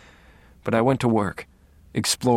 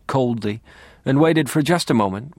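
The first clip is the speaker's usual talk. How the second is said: ordinary delivery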